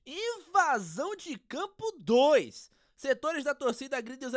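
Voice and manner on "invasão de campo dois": dramatic announcement. Acted. Announces second invasion.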